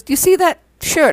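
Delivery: lightly voiced Charlie asking about the shirt; imitating Charlie.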